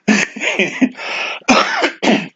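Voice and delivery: soft, wheezy chuckle; short amused laugh trailing upward; laughter. Real, not acted. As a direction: chuckle to yourself. Laughs at chat.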